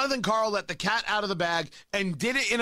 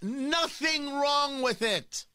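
slow, higher, shouted "Nothing wrong with it"; emphatic shout; 7 semitones higher, much slower than usual. Real, not acted. Host insisting to his audience the phrase is fine, slow shout. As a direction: hammer each word home. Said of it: "There's nothing wrong with that".

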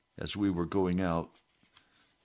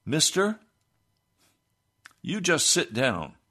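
higher, louder, telling him to sit down; a stern raised order to a man in church; firm command; 7 semitones higher, 5 dB louder than usual. Retold or imitated. Preacher ordering a testifying man to sit, firm and raised. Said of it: "So I said".

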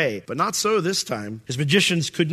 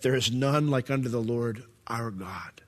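hushed whisper of awe; 5 semitones lower, 7 dB quieter than usual. Acted. Moses declaring God's supremacy to Pharaoh in a whisper. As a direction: breathe it out softly.

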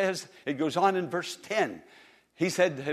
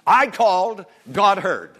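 rousing preacher; 3 semitones higher, 9 dB louder than usual. Real, not acted. Preacher checking the congregation gets the verse, rousing and loud. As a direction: drive it home to the congregation.

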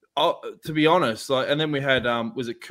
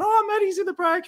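mimicking his mum, much higher and excited. Son imitating his excited mum at the restaurant, high-pitched. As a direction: squeal it like a thrilled mum. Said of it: "my mum's getting all excited, going".

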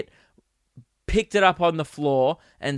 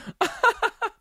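very high real laughter; helpless high giggling; high giggle. Real, not acted. Tickled by his own punchline, high giggle.

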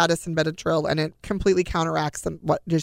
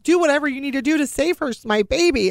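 desperate plea; 8 semitones higher, 4 dB louder than usual. Retold or imitated. Mother begging doctors to save daughter.

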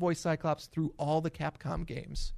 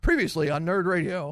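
mock series-recap announcer, louder; Cyclops imitation; 3 semitones higher, 6 dB louder than usual. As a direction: punch it like a cartoon episode opener. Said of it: "that's how every X-Men the Animated Series episode starts".

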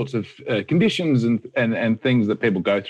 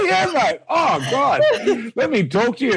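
a much higher laughing yell; 13 semitones higher than usual. Real, not acted. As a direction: agree while cracking up. Laughing agreement.

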